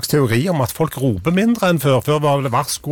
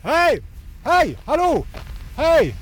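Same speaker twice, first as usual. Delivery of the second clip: shouting hello; 11 semitones higher, slower than usual. Real, not acted. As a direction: hail passers-by on the street. Reporter shouting at strangers. (roper hei høyt og lyst)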